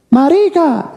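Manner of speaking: high yelled insult from the crowd; heckler's shout. Retold or imitated. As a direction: shout it from the audience. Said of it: "le gritó". (grito de un espectador)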